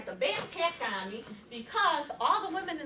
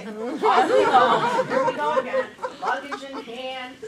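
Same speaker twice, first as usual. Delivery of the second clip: slow words broken up by a real laugh; 6 semitones higher, 11 dB louder, much slower than usual. Real, not acted. Telling the audience about her luggage trouble, laughing. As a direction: laugh through it at your own bad luck.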